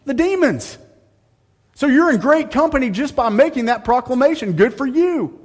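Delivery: high, loud, mocking sarcasm; sarcastic. Preacher mocking a claimed believer, loud sarcastic. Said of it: "he's being sarcastic here".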